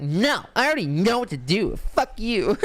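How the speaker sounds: put-on high, bratty kid voice; defiant mock retort. Kid mouthing off to his typing teacher, bratty high voice. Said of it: "I was like".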